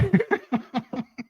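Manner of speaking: high, sudden laugh; high burst of laughter. Real, not acted. Laughing at forgotten hat.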